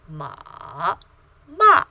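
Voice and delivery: ordinary talk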